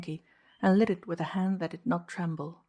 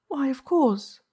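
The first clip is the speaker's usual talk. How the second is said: higher, plain assurance; simply; 8 semitones higher, 6 dB louder, slower than usual. A narrator's reading. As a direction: answer simply, higher and louder. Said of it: "she said simply".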